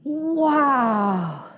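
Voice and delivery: leading the room in a wow of wonder. Shown as a demonstration. Scene: speaker leads audience saying wow, amazed. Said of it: "say that with me".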